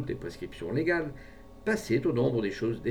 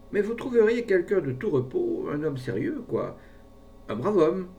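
persuasive; 8 semitones higher, 5 dB louder than usual. A narrator's reading. Pharmacist coaxing his client.

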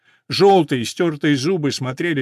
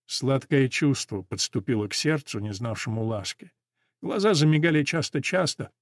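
ordinary delivery

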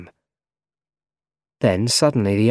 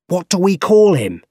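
emphatic shout, building higher and louder; shouting boss; 3 semitones higher, 4 dB louder than usual. A narrator's reading. Editor demanding a name from staff, shouting. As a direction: boom it out across the office. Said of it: "he shouted".

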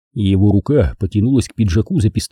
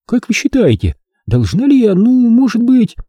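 hesitant; 13 semitones higher than usual. Acted. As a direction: stumble over the question. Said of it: "показался мне неуверенным".

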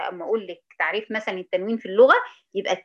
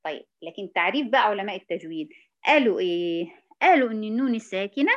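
usual speaking voice

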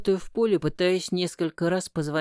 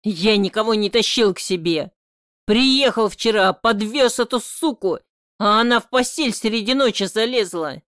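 louder, higher angry defence; heated shouting; 5 semitones higher, 7 dB louder than usual. A narrator's reading. Son defending himself to his father, shouting. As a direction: keep shouting your side.

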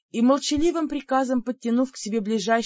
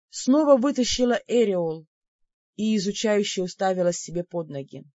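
plain reading voice

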